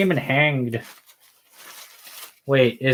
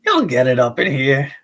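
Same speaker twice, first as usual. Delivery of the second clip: loud jokey y'all voice; put-on voice; 3 dB louder than usual. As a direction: punch it like a hype man. Continues the voiced bit.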